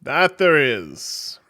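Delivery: loud, very slow reply; drawn-out, imposing voice of a god; booming voice. Acted. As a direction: boom it out grandly. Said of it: "you hear a booming voice".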